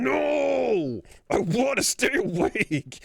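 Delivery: high, drawn-out mock toddler wail; protesting toddler. Dad imitating his daughter refusing sleep, high wailing protest. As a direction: imitate a small child fighting sleep. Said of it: "and then she's going".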